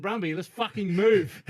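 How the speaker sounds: high, loud, slow emphatic demand; urgent retold plea. Urging Brumby away from the croc, loud urgency. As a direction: push each word out loudly, acting alarmed.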